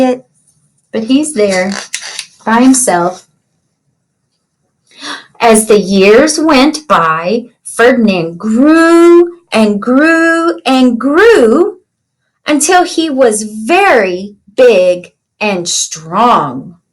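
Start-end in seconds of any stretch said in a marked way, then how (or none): 5.4-16.8 storybook reading. Acted. Teacher reads picture book aloud.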